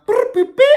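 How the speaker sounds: high beeping noise; beeping sound. Shown as a demonstration. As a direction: chirp it like a beeper. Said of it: "when I make this sound".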